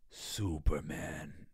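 low, very slow robot giant; slow, low, reverent giant saying its hero's name; Iron Giant voice. Retold or imitated. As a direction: draw it out deep like the gentle giant robot. Voicing the Iron Giant's line.

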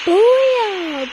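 high, loud triumphant booyah; loud cheer. Real, not acted. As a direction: shout it out like a victory cry. Celebrating a kill.

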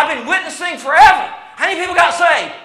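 high, loud acted complaint; exasperated testimony. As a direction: cry it out like a worn-out witness. Acting a frustrated believer's testimony.

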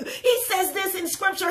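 ordinary talk

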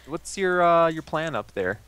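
ordinary delivery

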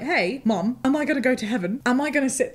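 a hint of a kid asking mom; little child. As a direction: pitch it up slightly like a curious child. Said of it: "my little squish comes to me".

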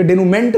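डेनुमेंट read English-style, ending "ment"; mistaken pronunciation. Shown as a demonstration. Teacher shows students a misreading, डेनुमेंट ending in "ment". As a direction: rhyme the end of डेनुमेंट with "movement", t sounded. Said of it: "नहीं पढ़ेंगे".

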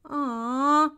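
loud, high sighed "aw"; exaggerated storybook disappointment; disappointed sigh. A narrator's reading. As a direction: sigh like let-down children. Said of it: "sighed the children".

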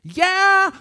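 high, loud call back; shouted answer. Acted. Jesus answers Peter across the lake, calling loudly. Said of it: "råber Jesus".

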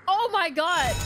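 ordinary talk, nothing marked

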